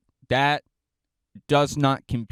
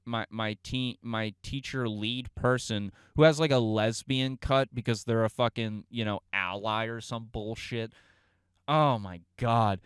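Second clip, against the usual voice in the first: ordinary delivery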